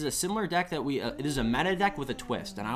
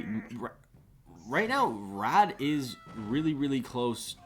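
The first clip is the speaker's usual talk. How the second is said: ordinary talk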